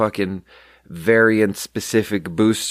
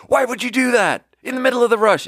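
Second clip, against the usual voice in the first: very high, mock screech; retold scream; 14 semitones higher than usual. Mimicking the coworker's complaint for laughs, high mock scream. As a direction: screech it in imitation of an outraged coworker. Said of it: "Lilith screamed at me".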